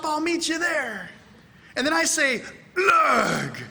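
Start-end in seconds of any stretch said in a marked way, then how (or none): 0.0-1.1 high, drawn-out eager reply; mock excitement. Acts out the eager code reply. 2.8-3.6 long, high, loud code word; mock code shout.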